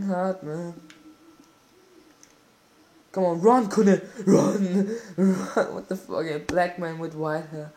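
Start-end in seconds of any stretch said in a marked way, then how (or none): 3.1-5.7 loud cries urging the runner; real excited cheering, louder, breaking into a laugh; urgent shouting. Real, not acted. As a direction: yell at the player to sprint. Urging his player to run.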